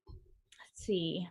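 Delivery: soft whispered aside; whispering. Real, not acted. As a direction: whisper it to yourself. Reading comments to herself.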